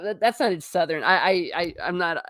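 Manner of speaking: ordinary delivery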